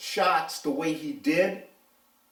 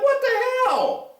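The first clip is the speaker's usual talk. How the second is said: stunned outrage, fading down as you lecture him; exasperated what the hell; 6 semitones higher than usual. Retold or imitated. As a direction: bark it out like you can't believe it. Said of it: "I'm like".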